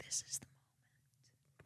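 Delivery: breathy, slow, hushed whisper; slow secret whisper to herself. Retold or imitated. Retelling her own thought.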